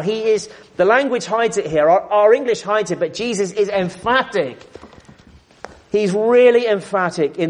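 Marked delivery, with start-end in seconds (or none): none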